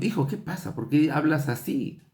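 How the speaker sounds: a high, startled question to his son; surprised father. Retold or imitated. Father startled to hear his small son curse, asking in surprise. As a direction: sound caught off guard by your child's curse. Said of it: "Yo me sorprendí por escucharlo".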